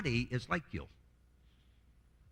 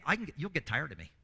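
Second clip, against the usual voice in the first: ordinary talk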